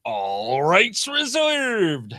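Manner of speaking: slow drawn-out showy sign-off; unusual tone. Shown as a demonstration. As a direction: stretch it like a game show host. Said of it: "the unusual tone".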